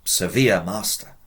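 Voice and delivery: normal talking voice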